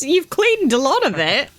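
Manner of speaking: ordinary delivery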